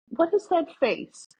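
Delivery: high, incredulous question; amused disbelief. Real, not acted. Looking at her friend's photo, high amused disbelief. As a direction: gasp at the ridiculous face.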